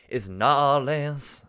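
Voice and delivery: "Nolans" drawn out long, high and loud; New Orleans as Nolans. Shown as a demonstration. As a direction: proclaim "Nolans" slowly in two stretched syllables. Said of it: "That's the rule now".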